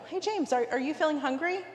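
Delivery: high, sweet coaxing ask; hint at hunger, sweetly and high; coaxing question. Retold or imitated. Wheedling James for food.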